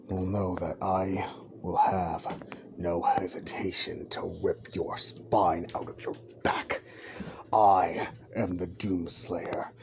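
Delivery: low, slow, deliberate threat; menacing declaration. Acted. Deku looks to the camera as Doomslayer, cold menace. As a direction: intone each phrase with long, ominous weight.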